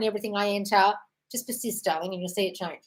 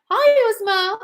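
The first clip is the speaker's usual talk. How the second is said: 1 bright greeting; 13 semitones higher, 7 dB louder, much slower than usual. Real, not acted. Greeting a viewer by name.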